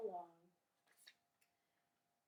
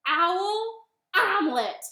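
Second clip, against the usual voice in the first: loud, high, drawn-out announcement; bunny's reveal; 10 semitones higher, 14 dB louder than usual. Acted. Bunny names Gruffalo's favorite food to owl, slow and loud. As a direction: land each word slowly and loudly. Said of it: "And the bunny said".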